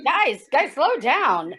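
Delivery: loud urgent call to slow down; loud warning. Acted. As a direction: call out to stop them. Stopping friends gulping.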